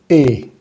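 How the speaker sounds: E as in Spanish, lips spread; smiling closed E. Shown as a demonstration. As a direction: smile while saying E.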